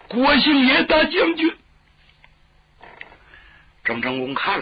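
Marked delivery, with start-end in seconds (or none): 0.1-1.6 high, loud wail as if crying to the general; acted sobbing plea, pitched way up; tearful wail. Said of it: "别哭，别哭， 怎么了？ 你们哭什么？".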